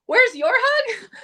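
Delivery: high, loud, drawn-out incredulous echo; mad. Retold or imitated. Telling how she answered his hug request, outraged. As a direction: throw it back at him, outraged. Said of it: "I was so mad".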